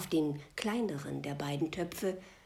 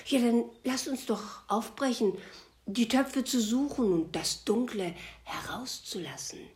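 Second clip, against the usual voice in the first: higher, louder, eager call to set off; 6 semitones higher, 5 dB louder than usual. Acted. The disciples urge the old man to set off, calling out eagerly. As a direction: urge everyone to leave at once. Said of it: "riefen seine Jünger".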